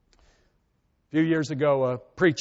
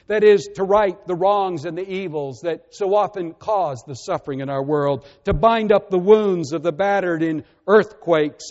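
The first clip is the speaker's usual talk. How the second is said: louder, rising sermon call to action; preacher lifting his voice to stir the congregation; impassioned preaching; 2 semitones higher, 6 dB louder than usual. Acted. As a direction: drive the list home from the pulpit. Preaching builds to full force.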